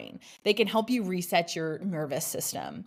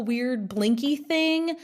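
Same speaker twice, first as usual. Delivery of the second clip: ordinary delivery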